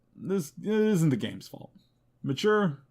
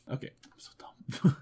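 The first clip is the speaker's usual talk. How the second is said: whisper; 4 dB quieter than usual. Real, not acted. Catching own misreading.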